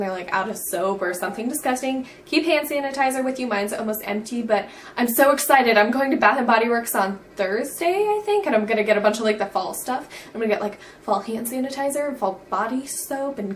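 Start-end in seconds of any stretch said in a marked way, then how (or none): none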